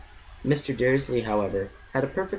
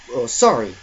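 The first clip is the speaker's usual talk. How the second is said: grunted; 7 dB louder than usual. A narrator's reading. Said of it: "he grunted".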